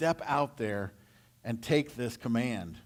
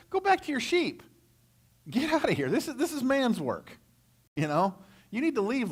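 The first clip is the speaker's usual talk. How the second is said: much higher, mocking big-brother yell; scornful yell; 10 semitones higher than usual. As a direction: shout the boy home with contempt. Brother Eliab chewing David out.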